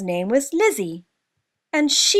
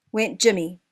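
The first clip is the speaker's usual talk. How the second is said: ordinary delivery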